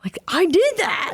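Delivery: rising high in amazement; slow, amazed exclamation; shocked disbelief. Retold or imitated. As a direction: sound floored by your own work. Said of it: "I was like".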